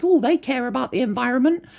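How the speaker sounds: high, naive put-on voice; mocking, credulous little voice; mock gullible viewer. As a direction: imitate an easily won-over customer, pitch climbing. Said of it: "you see this advert and you're like".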